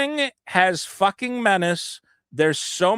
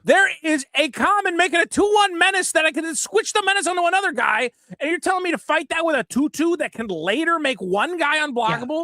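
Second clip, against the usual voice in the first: indignant rant; 9 semitones higher than usual. Real, not acted. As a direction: rail against how unfair it is. Ranting about unfair card design.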